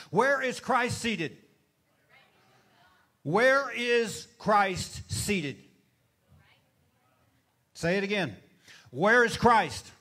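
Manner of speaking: loud, slow call to the congregation; preaching shout. Real, not acted. Preacher calling on the congregation for an answer, loud and slow. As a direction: drag each word out and belt it. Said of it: "Talk back to me today".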